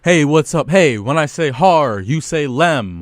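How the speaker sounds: loud, rhythmic crowd call; hype call-and-response. Real, not acted. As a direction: pump up the crowd.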